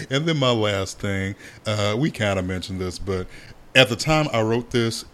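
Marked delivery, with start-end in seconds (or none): none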